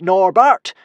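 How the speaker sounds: high, loud yell; loud shout. A narrator's reading. Hiccup calling out Norbert's name, loud shout. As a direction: shout it as loud as you can. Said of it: "shouted Hiccup as loud as he could".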